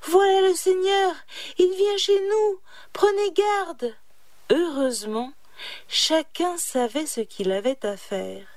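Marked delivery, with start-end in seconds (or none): 0.0-3.9 high, loud warning cry; shout. A narrator's reading. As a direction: cry out the alarm to the family.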